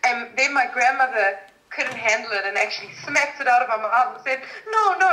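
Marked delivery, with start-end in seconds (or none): 4.7-5.1 high, loud Hungarian-accented protest, imitated. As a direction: mimic a Hungarian grandmother objecting. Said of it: "in her Hungarian accent".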